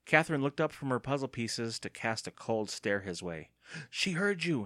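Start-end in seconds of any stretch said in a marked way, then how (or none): 3.9-4.6 high-pitched alarmed whisper-free cry; horrified cry. A narrator's reading. Andy after the aunt's cold stare, horrified cry. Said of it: "cried, horrified".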